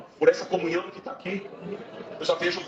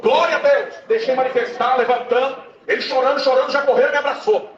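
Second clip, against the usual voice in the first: much higher and louder, shouting the testimony; preaching at full force; 9 semitones higher, 7 dB louder than usual. Acted. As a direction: drive the story home to the congregation, shouting. (pregação gritada com fervor)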